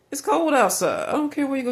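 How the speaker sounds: high, loud pleading complaint; loud plea. Retold or imitated. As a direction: beg loudly, voice up high. Thrown out into the cold.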